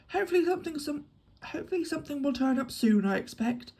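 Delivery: lifted, hopeful musing; hope something turns up; lightly hopeful guess. Acted. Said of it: "he remarked hopefully".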